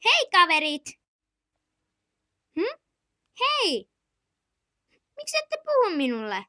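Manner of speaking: put-on lonely child calling to friends. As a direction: shout over to the other kids.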